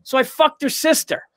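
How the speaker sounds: put-on comic redoes his old opener, punchy; stand-up punchline. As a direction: land the punchline on stage. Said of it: "Can you deliver that the way you delivered it?".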